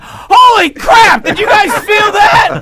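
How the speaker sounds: high, slow, shouted panic; hammed-up freaked-out pilot yelling; panicked shout. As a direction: yell it like a terrified pilot. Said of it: "if the pilot got on and went".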